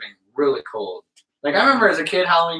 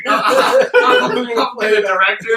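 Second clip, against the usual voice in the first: laughing; 6 semitones higher, 4 dB louder, much slower than usual. Real, not acted. Laughing mock boasting.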